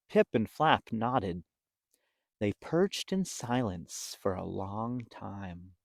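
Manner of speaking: usual speaking voice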